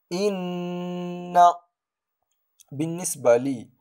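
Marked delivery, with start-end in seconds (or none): 0.1-1.4 إن with the doubled n held long and soft; sustained nasal nn in إن; nasal ghunna. Shown as a demonstration. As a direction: hum on the ن of إن before releasing it. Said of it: "هذا الصوت يخرج من الخيشوم".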